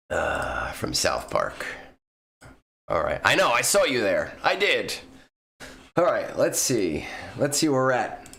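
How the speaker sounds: ordinary talk, nothing marked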